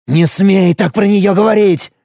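high, tense angry warning; enraged but restrained shout; furious. A narrator's reading. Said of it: "рассвирепел Пашка".

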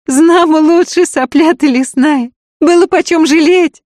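loud, high, scornful woman's retort; ringing shout. A narrator's reading. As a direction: ring it out across the whole yard. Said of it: "звонко на весь двор ответила".

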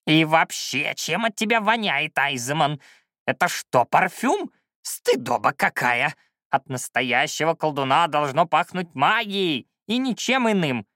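put-on cranky old man, pitch jumping high; scolding old shaman. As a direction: grumble in high-pitched disgust. Old shaman scolds about perfume.

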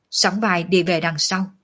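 ordinary delivery

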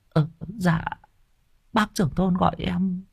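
high, soft, hesitant woman's voice; small, sheepish, high voice; timid village woman. Acted. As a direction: answer the chief meekly.

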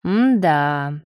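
lazily drawn-out appraisal; drawled. A narrator's reading. Marat sizing up naked Leo, drawled. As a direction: draw the sound out. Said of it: "протянул Марат".

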